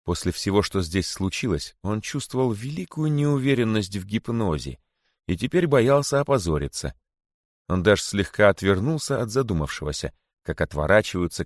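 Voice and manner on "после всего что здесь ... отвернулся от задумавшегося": plain reading voice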